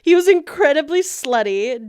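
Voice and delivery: ordinary talk, nothing marked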